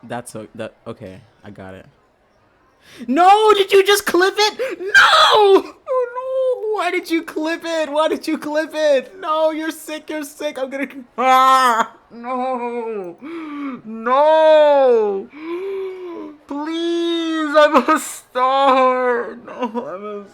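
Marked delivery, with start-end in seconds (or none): 3.1-6.8 high, very loud anguished screams; screaming outrage. Real, not acted. Streamer screaming at a friend for clipping her. 6.8-7.9 screamed. Real, not acted. 7.9-20.2 high, very loud anguished screams; screaming outrage. Real, not acted. Streamer screaming at a friend for clipping her.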